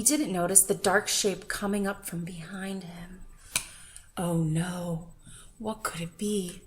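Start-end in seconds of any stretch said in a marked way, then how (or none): none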